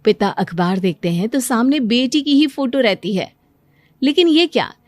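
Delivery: plain reading voice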